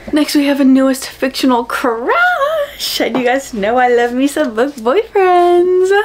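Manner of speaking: bubbly and thrilled; excited. Real, not acted. Excited intro of crush.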